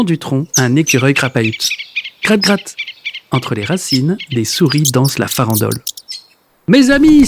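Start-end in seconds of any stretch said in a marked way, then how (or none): 2.2-2.8 higher, brighter scratching sound; a pitched-up, lively scratch-scratch. Acted. The mice are really back. 6.7-7.3 much higher, louder happy exclamation; a loud, high joyful cry to his friends. Acted. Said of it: "s'écrie Monsieur Marcelin".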